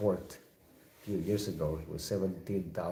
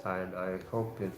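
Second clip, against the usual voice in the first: ordinary delivery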